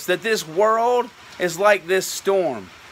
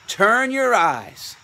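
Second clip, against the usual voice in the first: ordinary talk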